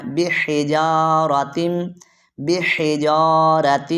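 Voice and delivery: "بحجارت" slow, with H from the middle of the throat. Shown as a demonstration. Teacher models a throat letter for students, slow mid-throat H in "بحجارت". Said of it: "حلق کے درمیان والے حصے سے ادا کرنا ہے".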